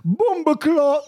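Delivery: high, slow Jamaican patois; Jamaican accent. Pretend. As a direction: play up a Jamaican rude boy. Patois punchline, high and far.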